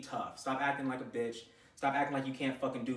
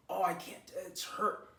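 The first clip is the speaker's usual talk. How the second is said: mock whiny complainer; 3 semitones higher, 5 dB quieter, slower than usual. Coach mimics a trainee complaining of soreness, mock whiny. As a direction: imitate a feeble excuse.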